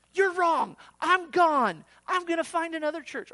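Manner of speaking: loud, high indignant outburst; angry defiance. Acted. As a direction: storm off in hammed-up anger. Acted angry church member walking out.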